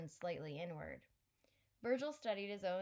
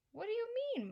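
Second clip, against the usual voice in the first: surprised; 4 dB louder, faster than usual. Acted. Boy surprised at extra thumb.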